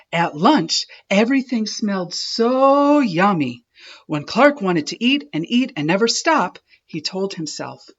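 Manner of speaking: ordinary delivery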